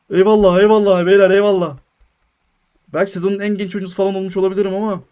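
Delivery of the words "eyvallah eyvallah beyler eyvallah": loud thanks. Real, not acted. Accepting award from viewers.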